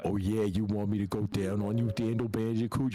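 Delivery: low Jersey tough-guy come-on; mock-lewd Jersey accent. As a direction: tease crudely in low, thick Jersey. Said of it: "getting horny over your Jersey accent".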